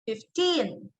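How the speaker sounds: "fifteen" with stress on its second syllable; stress on TEEN. Shown as a demonstration. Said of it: "you give stress to the word teen".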